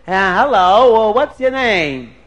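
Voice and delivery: loud, drawn-out hello; pushy suitor sizing her up; forward greeting. Acted. Prince goes over to Dina, forward greeting.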